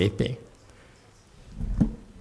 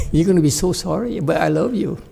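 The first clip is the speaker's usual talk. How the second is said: higher, sing-song love with a hidden threat; mock sweetness masking a threat; sweet menacing bodhisattva; 6 semitones higher, faster than usual. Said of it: "Equal enlightened Bodhisattva".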